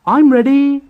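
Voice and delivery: high, loud and eager. A narrator's reading. Edward behind the brake van, high loud eager call. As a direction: call it out keen.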